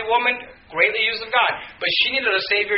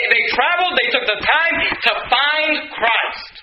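higher-pitched, driving sermon delivery; fervent preaching; 5 semitones higher than usual. Real, not acted. Preacher urging the congregation on the wise men's journey, fervent preaching. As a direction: preach it insistently, pitched up, easing down at the end.

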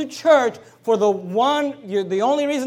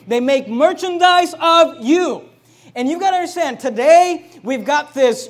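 preach; 5 semitones higher, 6 dB louder than usual. Acted. Pastor to congregation, preaching hard and loud. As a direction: pound each question home from the pulpit.

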